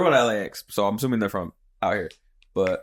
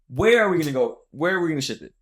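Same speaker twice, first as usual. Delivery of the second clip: higher-pitched exasperated questions, mildly mimicked; retold snappish outburst, pitched up; mock yelling; 7 semitones higher, 4 dB louder than usual. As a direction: imitate a fed-up girlfriend challenging her boyfriend. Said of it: "yelling at this fool".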